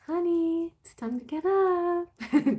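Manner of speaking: put-on high, sweet and slow, like coaxing a sleepy child; gentle wake-up voice. Mother waking her sleeping kid, high and tender. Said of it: "That's my way".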